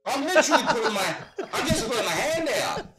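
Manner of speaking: high, loud, fast exasperated retort; exasperated protest. Retold or imitated. To his wife about dressing up, high exasperated protest. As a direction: squeal it out like you can't believe she'd ask.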